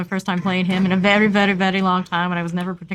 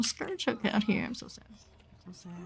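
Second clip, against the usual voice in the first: whispered; 2 semitones higher, 11 dB quieter than usual. Real, not acted. Whispered disappointment.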